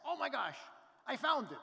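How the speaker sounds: imitating a thrilled friend on the phone; excited friend. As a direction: burst out like you've struck gold. Friend's excited phone call retold.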